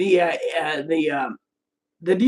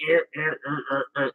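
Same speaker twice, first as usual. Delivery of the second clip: low, quiet grunted noises; imitated grumbling; 6 semitones lower, 9 dB quieter than usual. Chloe's answer about the cigarette carton, imitated grumbling.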